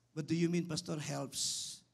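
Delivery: ordinary delivery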